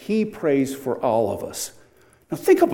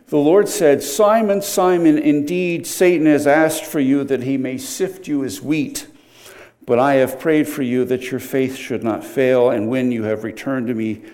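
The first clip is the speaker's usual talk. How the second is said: louder, measured scripture reading; declaiming the Lord's words from the pulpit; 5 dB louder than usual. Acted. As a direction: read the verse out with weight. Preacher reads scripture aloud.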